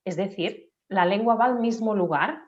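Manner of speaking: normal talking voice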